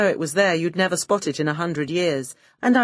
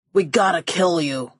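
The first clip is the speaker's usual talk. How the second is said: thug threat. Acted. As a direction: state it like a bored hired gun.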